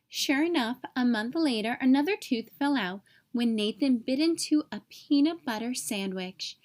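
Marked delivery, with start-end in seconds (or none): none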